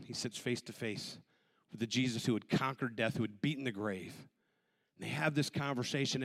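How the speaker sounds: ordinary talk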